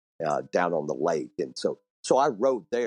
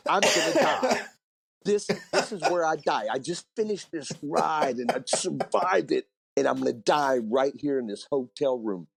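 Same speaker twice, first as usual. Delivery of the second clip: higher, half-laughing mock panic; 7 semitones higher than usual. Pinned by the bed, he recalls his thoughts in mock panic. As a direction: ham up the drunken dread of dying here.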